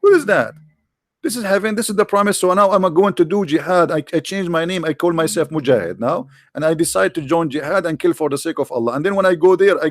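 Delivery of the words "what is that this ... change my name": normal talking voice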